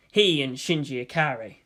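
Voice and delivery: ordinary delivery, nothing marked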